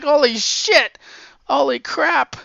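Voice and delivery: loud, high, delighted exclamation; a fan who can't believe his luck; thrilled disbelief. Retold or imitated. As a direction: gasp it out like the best news ever. Reader reacting to Blade news.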